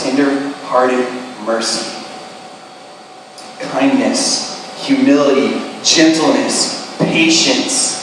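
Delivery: quiet, tender list; soft and gentle. Acted. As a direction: speak each virtue gently. Jesus's list, said softly.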